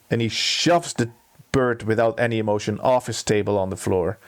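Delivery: ordinary talk, nothing marked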